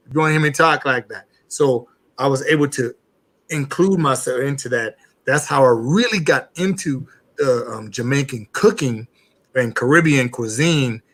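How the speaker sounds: ordinary talk, nothing marked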